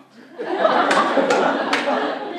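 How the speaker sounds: loud breathy laugh. Acted. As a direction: burst out laughing. Laugh after monologue line.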